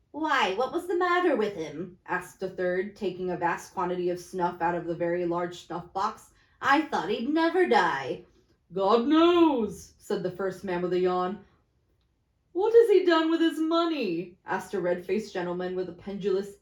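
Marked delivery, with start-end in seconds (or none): 0.1-2.0 lightly higher gossiping voice; snuff-taking merchant. Acted. Third merchant asking idly, slightly higher gossip voice. 6.6-9.9 louder blunt businessman; lightly voiced fat merchant. Fat merchant shrugging off the death, loudly blunt. 12.5-14.4 higher, louder greedy question; pry eagerly about the money; red-faced gentleman. Acted. Red-faced gentleman prying about money, higher and louder.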